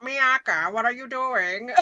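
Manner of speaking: mock dub villain voice; hamming up a bad dub villain; bad English dub voice. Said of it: "Tomo sounds like this".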